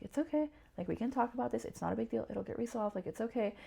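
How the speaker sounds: calm her down gently while holding her hand; calm reassurance. Retold or imitated. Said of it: "he was just so calm, like, just held my hand".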